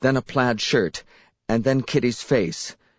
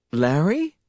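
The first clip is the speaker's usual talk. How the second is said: bewildered; 6 semitones higher than usual. A narrator's reading.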